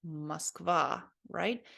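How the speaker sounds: usual speaking voice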